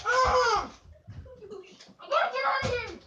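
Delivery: high, loud and fast; panicked rush. Real, not acted. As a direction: scramble away yelling. Fleeing during Nerf war.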